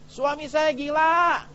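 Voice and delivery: high, slow mock wail of an angry wife; preacher imitating a wife yelling that her husband is crazy; imitating a screaming wife. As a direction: play the wife yelling outside the house, hammed up. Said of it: "lagi marah teriak di depan rumah".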